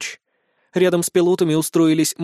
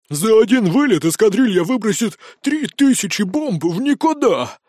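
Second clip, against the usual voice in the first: higher, louder outraged squeal; indignant squeal; 5 semitones higher, 3 dB louder than usual. A narrator's reading. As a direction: squeal in protest over waste. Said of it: "возмущённо завизжал".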